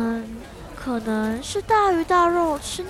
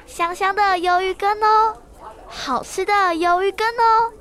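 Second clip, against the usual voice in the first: loud, higher sing-song call; calling out to customers; 4 semitones higher, 5 dB louder than usual. Acted. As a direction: sell the squid soup to passers-by. Girl calling out at stall. (女孩在摊位前大声叫卖)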